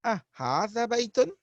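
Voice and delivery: أهذا بيت rising at the end; أهذا said with a clear rising close; question tune. Shown as a demonstration. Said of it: "we can add a in front of it".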